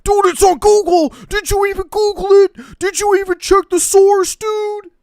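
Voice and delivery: high, loud, fast whiny mockery; shrill mocking of a Twitter leftist lecturing. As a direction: screech it like a smug online know-it-all. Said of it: "This is every single Twitter leftist".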